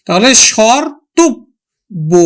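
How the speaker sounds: স্বর্তব্য said slowly, syllable by syllable, as shorto-tobbo; teacher sounding out স্বর্তব্য; slow pronunciation demo. Shown as a demonstration. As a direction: draw out স্বর্তব্য for the class. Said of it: "উচ্চারণ হলো কিন্তু".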